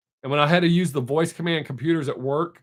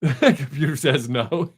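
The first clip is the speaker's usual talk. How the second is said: slow catchphrase breaking into laughter; laughing; slower than usual. Real, not acted. Joking to viewers with a catchphrase, real laughing. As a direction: crack up as you finish the line.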